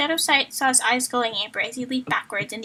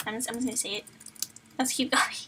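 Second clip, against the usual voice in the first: plain reading voice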